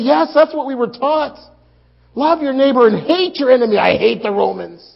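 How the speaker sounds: loud, fast and bitter; fierce hatred. Acted. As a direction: spit out the hatred. Zealous hatred of Romans.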